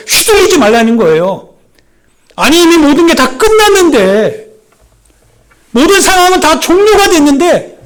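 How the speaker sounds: high, loud, rising sermon cry; impassioned preacher climax; preaching at full force. Acted. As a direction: press the point hard on the congregation.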